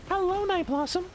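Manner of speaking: very high goblin greeting. Shown as a demonstration. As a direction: greet them in a squeaky-high goblin pitch. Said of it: "Or the goblin voice".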